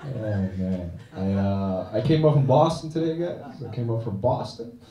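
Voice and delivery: ordinary talk, nothing marked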